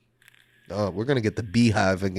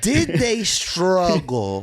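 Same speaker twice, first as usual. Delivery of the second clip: slow, high sneering question; mocking; 7 semitones higher, 4 dB louder, slower than usual. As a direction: drag it out like a snob. Mocking punk authenticity gatekeepers.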